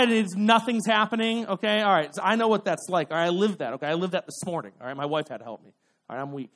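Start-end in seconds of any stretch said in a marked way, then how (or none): none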